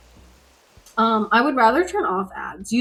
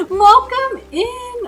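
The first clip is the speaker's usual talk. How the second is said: laughing welcome; 12 semitones higher, 5 dB louder, much slower than usual. Real, not acted. Greeting a raider with laugh.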